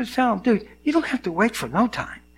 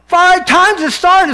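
higher, louder, slow; triumphant; 9 semitones higher, 6 dB louder, slower than usual. Real, not acted. Pastor telling church the car started, triumphant. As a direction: land the miracle proudly.

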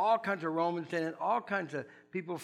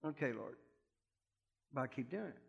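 sheepish reply; 5 semitones lower, 12 dB quieter, faster than usual. Retold or imitated. Pastor's weak reply to God.

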